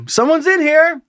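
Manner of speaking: polite high call through a door; high-pitched call out. A narrator's reading. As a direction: call out that the stall is taken. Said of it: "this is our little exchange".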